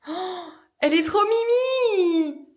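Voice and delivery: high, slow, gushing delight; delighted squeal. Real, not acted. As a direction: coo over something adorable. First sight of cute bathroom.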